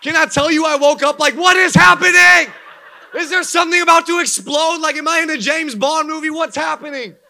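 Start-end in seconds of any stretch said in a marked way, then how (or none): 1.3-7.2 loud, high panicked yelling; startled half-laughing shout; mock panic. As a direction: act out waking up in terror at the alarm. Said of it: "I was scared".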